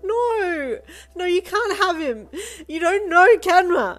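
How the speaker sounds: high, very loud, drawn-out wail. A narrator's reading. Child fights for Kenma, wailing. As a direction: scream it out, stretching the words. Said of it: "you wailed".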